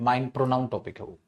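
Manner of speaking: ordinary delivery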